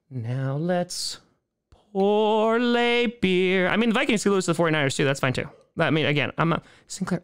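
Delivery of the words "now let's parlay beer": drawn-out call. Acted. Drawn out announcement.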